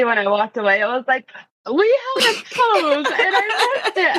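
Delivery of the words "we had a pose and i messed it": 2 higher, laughing mock despair; 3 wail it like a comic tragedy while laughing. Retelling her panic after photo.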